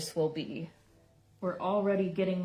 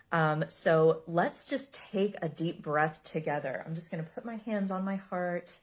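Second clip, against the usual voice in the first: guided meditation; 4 dB quieter than usual. Acted. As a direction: ease everyone into calm breaths. Leads a breathing meditation.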